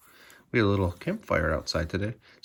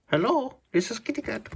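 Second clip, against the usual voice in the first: lightly voiced cat answering a phone, higher; pretend phone voice. Owner playfully voicing the cat answering a banana phone, higher. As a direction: play the cat picking up a call.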